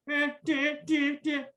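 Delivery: high squeaky stab sounds; mock stabbing noises. Cameraman miming stabs, high squeaky sound effects. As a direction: chirp each stab high and quick. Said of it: "our cameraman's like".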